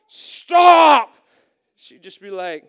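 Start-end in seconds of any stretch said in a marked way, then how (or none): 0.1-1.1 loud, high cry of stop; shouted command. Retold or imitated. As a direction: shout it to halt someone cold. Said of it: "because instead of saying".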